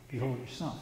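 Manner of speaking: very soft, low dying words; barely able to talk. Retold or imitated. Dying Jesus turns to Mary, barely able to talk. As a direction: imitate a faint last effort to speak. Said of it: "Jesus can barely talk now".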